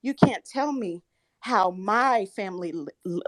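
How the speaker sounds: ordinary talk, nothing marked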